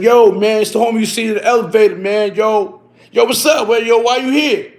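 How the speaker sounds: friendly neighbor act. Retold or imitated. At the stick-up kids' door, posing as a friendly neighbor, loud and casual.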